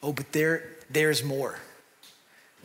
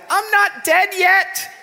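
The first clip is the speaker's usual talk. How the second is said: much higher, slow, squeaky comic voice; Monty Python impression; 13 semitones higher, 5 dB louder, much slower than usual. Preacher imitating the not-dead man from Monty Python, high and slow. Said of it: "the guy's going".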